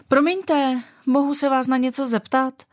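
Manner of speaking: calling out. A narrator's reading. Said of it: "zavolala na ní Šťastenka". (výš, zdvořilé zavolání)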